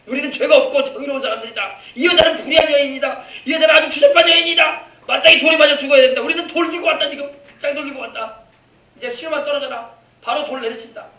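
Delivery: high-pitched, puffed-up denunciation; triumphant self-righteous accusers. Retold or imitated. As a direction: act out a self-righteous mob passing judgement. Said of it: "의기양양하게 자기들은 의인인 척하면서 왔어".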